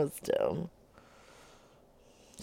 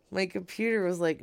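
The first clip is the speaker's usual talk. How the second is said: usual speaking voice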